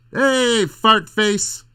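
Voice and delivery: high, loud cartoonish mock insult; hammed-up insult. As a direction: belt it out like a silly schoolyard taunt. Hammed-up insulting greeting for laughs.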